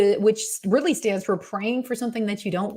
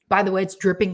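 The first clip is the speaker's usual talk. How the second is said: usual speaking voice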